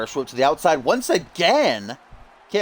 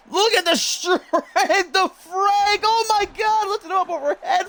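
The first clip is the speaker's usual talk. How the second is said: amazed shout; 14 semitones higher than usual. Real, not acted. Commentator amazed at lift.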